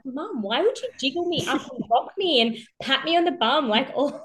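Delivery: put-on high, laughing child's protest; daughter's voice. Daughter asking her mum, high and laughing. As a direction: plead like an indignant six-year-old.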